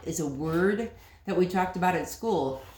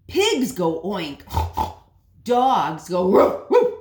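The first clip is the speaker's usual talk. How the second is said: louder, slow animal sounds; insistent mother; 10 dB louder, slower than usual. Acted. Frustrated mother repeats the lesson, loud and slow. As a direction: spell it out firmly.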